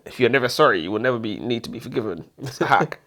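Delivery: usual speaking voice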